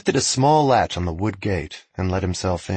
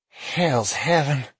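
slow, higher awed voice; awed young messenger; 5 semitones higher, slower than usual. Acted. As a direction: breathe it out in awe. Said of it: "the messenger said".

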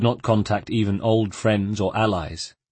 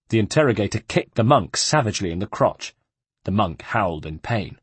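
ordinary delivery, nothing marked

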